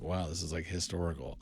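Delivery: soft, low, impressed murmur; understated wonder, dropped in volume; hushed awe. Retold or imitated. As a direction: quietly marvel as you recall it. Recalls being awed by director's work.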